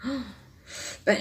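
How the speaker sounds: usual speaking voice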